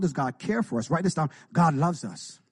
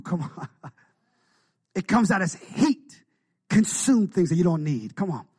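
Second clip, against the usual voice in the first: usual speaking voice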